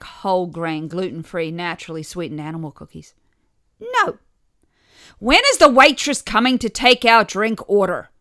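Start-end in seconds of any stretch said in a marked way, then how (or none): 3.8-4.2 put-on toddler no. A narrator's reading. Two-year-old refusing cookie. 5.2-8.1 loud, raised call to the room; half-deaf yell. A narrator's reading. Deaf father yelling for the waitress into the open space, loud. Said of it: "yelled my half-deaf father".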